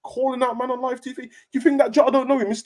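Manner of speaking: mocking incredulous repeat of calling out; incredulous echo. Real, not acted. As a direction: throw the words back like they're absurd. Mocking the fans' complaint.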